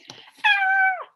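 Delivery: high, loud frightened scream; kids' scream. Acted. Three kids startled by the monster, screaming. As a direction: shriek in sudden terror. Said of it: "we screamed".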